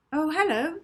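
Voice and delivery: high, bright little hello from Molly; girl's greeting. Acted. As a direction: pitch it up as the girl. Molly greets Knockman warmly.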